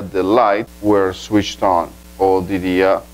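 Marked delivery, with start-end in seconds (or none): 0.0-1.9 slow, clearly separated English words; slow example reading. Shown as a demonstration. Teacher demonstrating the passive without an agent, slow and clear. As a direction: lay the sentence out slowly. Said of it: "En voz pasiva simplemente diría".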